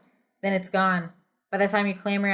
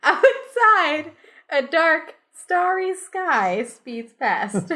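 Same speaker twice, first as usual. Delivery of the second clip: high, giggly narration; narrating with real laughter; laughing through it; 9 semitones higher, 5 dB louder, much slower than usual. Real, not acted. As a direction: fight off the giggles. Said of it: "You wanna start that up?".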